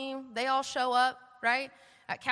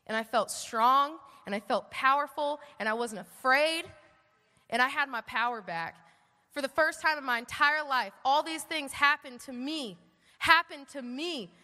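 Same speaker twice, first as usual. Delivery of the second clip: ordinary delivery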